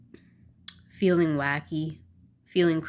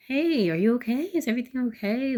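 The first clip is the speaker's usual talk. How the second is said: higher-pitched worried check-in; concerned; 8 semitones higher than usual. Retold or imitated. Coach checking on a student walking into class, concerned. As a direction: imitate an anxious, caring coach. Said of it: "so concerned".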